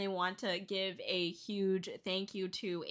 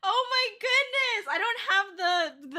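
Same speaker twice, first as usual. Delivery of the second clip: high, drawn-out squeal of disbelief; real teary shock, pitched way up; tearful amazement; 12 semitones higher, 6 dB louder, slower than usual. Real, not acted. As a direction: cry out, overwhelmed by the gift. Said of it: "I gotta wipe my tears".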